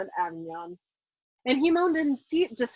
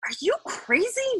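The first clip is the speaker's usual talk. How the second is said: plain reading voice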